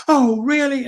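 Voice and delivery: high, eager burst of excitement; suddenly thrilled at the news; imitating a sudden jump to excitement; excited. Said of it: "now he's really excited".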